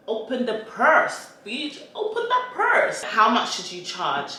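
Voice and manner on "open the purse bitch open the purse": sassy, high-pitched demand for money; hammed-up diva insistence; playful demand. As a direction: demand it with mock outrage, pitch soaring on the repeat. Playful demand to imagined brands.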